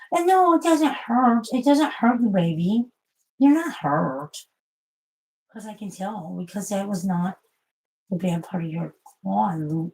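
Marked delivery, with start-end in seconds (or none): 0.1-4.4 higher, louder slow comfort to the cat; higher slow reassuring pet talk. Real, not acted. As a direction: soothe the cat, stretching it out.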